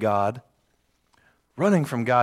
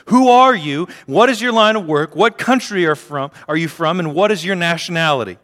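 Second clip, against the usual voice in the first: loud, much higher questioning; demanding sailors; 5 semitones higher, 6 dB louder than usual. Acted. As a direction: fire the questions at him. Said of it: "they demanded".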